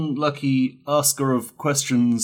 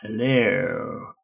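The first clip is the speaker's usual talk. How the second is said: lightly voiced creepy uncle hello; slightly creepy drawn-out greeting. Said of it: "Just a real creepy uncle voice".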